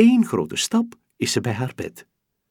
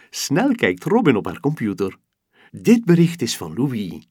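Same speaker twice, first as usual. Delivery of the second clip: ordinary delivery, nothing marked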